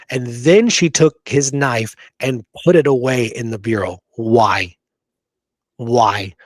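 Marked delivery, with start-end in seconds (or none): none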